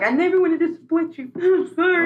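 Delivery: hammed-up tearful sorry; mock sobbing. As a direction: plead like a crying kid.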